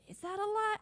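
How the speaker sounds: high-pitched, unsure question; puzzled questioning. Acted. Doubting a date's accented words, puzzled. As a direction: wonder aloud if it is a lie.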